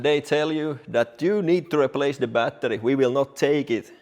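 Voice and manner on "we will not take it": normal talking voice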